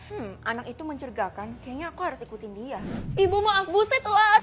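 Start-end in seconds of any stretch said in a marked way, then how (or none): 3.2-4.4 breathless loud apology. Acted. Running in late, apologizing.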